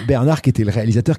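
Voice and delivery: ordinary delivery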